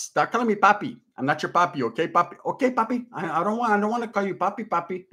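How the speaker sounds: lightly voiced Puerto Rican accent, coked-up; a hint of a wired Puerto Rican dude; playful Puerto Rican accent impression; Puerto Rican cop-show guy. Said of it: "be like the Puerto Rican dude on Cops".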